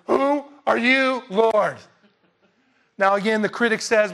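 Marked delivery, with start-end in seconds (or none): 0.1-1.8 loud, high, slow cry with every word drawn out; awestruck Paul. Acted. Preacher showing how Paul would have asked, loud and overwhelmed. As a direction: call it out slowly at full voice, overwhelmed. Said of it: "Paul would have went".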